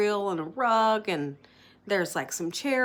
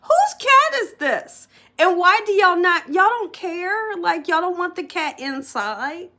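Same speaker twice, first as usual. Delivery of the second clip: much higher, louder incredulous squeal; outraged disbelief; 10 semitones higher, 6 dB louder than usual. Retold or imitated. Telling viewers how she reacted to the cat: high, loud, incredulous. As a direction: shriek at the neighbours' loose cat. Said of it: "I'm like".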